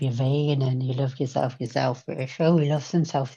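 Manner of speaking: normal talking voice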